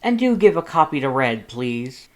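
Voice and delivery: bold, booming Papyrus voice; loud, grand Papyrus. A narrator's reading. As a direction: proclaim it like a self-important hero.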